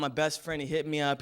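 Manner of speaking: ordinary talk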